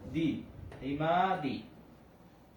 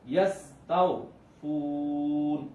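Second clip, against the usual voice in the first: ইয়াস্তাউফুন drawn out with a quick তাও; slow recitation; 3 dB louder, much slower than usual. Shown as a demonstration. Teacher reading the example for students, slow. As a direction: read তাও quickly but stretch ফু. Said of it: "তাও আমরা দ্রুত পড়ছি".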